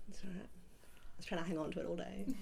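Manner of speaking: soft, whispery, worn-out voice; losing voice. Real, not acted. Interviewee trying to hang on to failing voice all day, losing voice. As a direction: voice fading to a whisper. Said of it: "I'm losing my voice".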